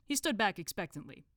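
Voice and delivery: usual speaking voice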